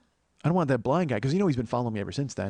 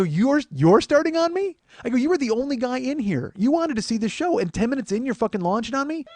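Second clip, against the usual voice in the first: indignant retort; 9 semitones higher, 3 dB louder than usual. Retold or imitated. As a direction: reenact disbelief at a heckler. Comic retells yelling at heckler.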